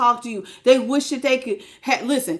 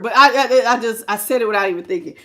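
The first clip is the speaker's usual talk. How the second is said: normal talking voice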